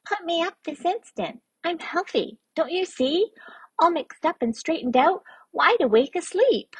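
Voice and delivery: high, squeaky, upset little voice; little creature tantrum. Acted. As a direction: throw a fit in a tiny squeaky voice. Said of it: "he threw a fit".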